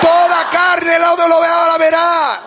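high shouted declarations with held endings; proclamación a gritos. Real, not acted. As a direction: lead the crowd, stretching each last word. Leads congregation in shouted declarations. (proclamación aguda y sostenida a gritos)

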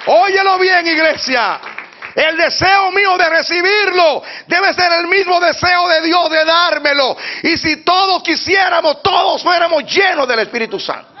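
high, ringing exhortation to the church; full-force preaching. Real, not acted. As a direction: preach it out in slow, high shouts.

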